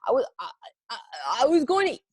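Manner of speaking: high, halting stammer, tumbling out fast at the end; stammering in shock. Acted. Mister Bing caught eating the donut, shocked stammer. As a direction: caught red-handed and lost for words. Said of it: "he replied in shock".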